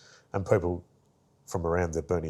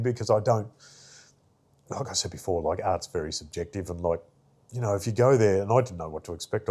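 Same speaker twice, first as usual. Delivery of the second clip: usual speaking voice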